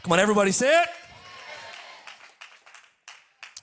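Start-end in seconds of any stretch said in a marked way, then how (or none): 0.0-0.9 louder rally call to the room. Real, not acted. Pastor urging the congregation to say amen, called out. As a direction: rouse the crowd to answer.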